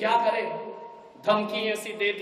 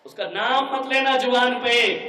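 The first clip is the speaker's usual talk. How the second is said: scornful rant; 5 semitones higher, 8 dB louder than usual. Acted. Daughter insults her in-laws.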